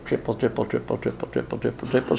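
a quiet, fading drip-drip imitation; soft dripping sound. Sound of the child's puddle.

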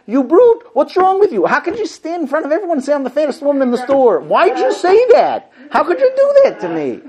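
high, loud outraged shriek; hammed-up fury at a tactless husband; outraged wife. Wife scolding husband in store, hammed-up shriek. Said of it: "And she goes".

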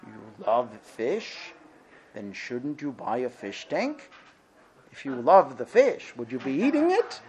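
lightly voiced old rabbi questioning a student; a hint of a wise old rabbi teaching. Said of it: "So the rabbi looks at him and says".

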